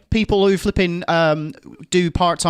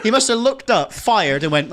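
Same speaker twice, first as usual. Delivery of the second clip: ordinary talk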